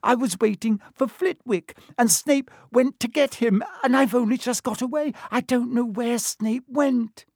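very high, plaintive wail; upset, high-pitched lament. A narrator's reading. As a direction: pour out the failure in a distressed high voice. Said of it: "she wailed".

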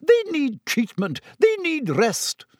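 very high, slow indignant protest; sputtering. A narrator's reading. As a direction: protest for your patients, pitch soaring. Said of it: "sputtered Madame Pomfrey".

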